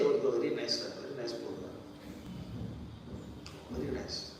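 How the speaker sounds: butter up the sponsor in a soft murmur; mock flattery. Preacher mimicking flattery of non-devotees, soft fawning murmur.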